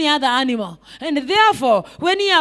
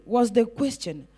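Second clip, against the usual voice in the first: ordinary talk, nothing marked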